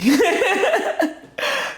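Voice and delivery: a burst of high, loud laughter; high delighted laugh. Real, not acted. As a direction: laugh out high with pure joy. Laughing about happiest time.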